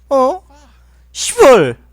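loud high curse; angry outburst. Real, not acted. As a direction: blurt it out furiously. Dies in game.

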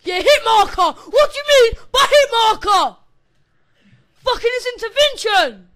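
high, very loud outraged yell; real gamer outburst; excited shouting. Real, not acted. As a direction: scream in disbelief at the screen. Yelling at a lucky game hit.

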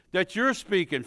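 ordinary talk, nothing marked

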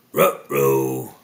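loud, low dog-voiced uh-oh; dismayed cartoon dog, growled R's; Scooby-Doo. A narrator's reading. Scooby as the snacks fly out, dismayed dog voice. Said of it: "Scooby cried".

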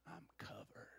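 soft whisper on covered. Shown as a demonstration. Pastor showing the church the gesture, whispered. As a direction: breathe the word covered. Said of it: "when we say covered, you're gonna go like this".

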